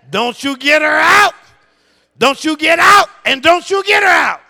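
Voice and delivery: high, booming shouted decree; retold stern command. Preacher reminds congregation of wife's word, shouted decree. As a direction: thunder the order like law from on high. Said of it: "'Cause the word said".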